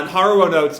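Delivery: usual speaking voice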